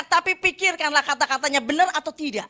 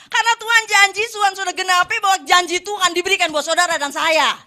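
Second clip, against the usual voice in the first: loud, higher, full-force preaching; 4 semitones higher, 7 dB louder than usual. Real, not acted. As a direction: proclaim it to the whole congregation. (khotbah berapi-api, lantang)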